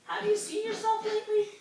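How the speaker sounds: high playful frog for the class; teasing frog. Acted. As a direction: tease him in a high frog voice. Friendly frog teases guppy.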